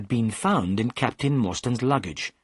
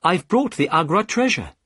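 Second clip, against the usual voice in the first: higher, brighter put-on cheer; mildly faked delight; forced happiness; 7 semitones higher, 4 dB louder than usual. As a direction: announce it with effortful gladness. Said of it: "trying to speak happily".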